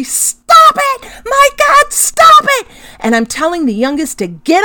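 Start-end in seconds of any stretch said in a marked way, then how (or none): 0.0-2.7 high, loud, desperate shouts; mother yelling at Henry to quit hitting his brother, high and loud; retold yelling. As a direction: yell it at him to make the hitting stop. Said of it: "I'm yelling at Henry".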